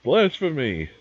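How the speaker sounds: loud mock outraged yell; scream. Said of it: "Somebody above screamed".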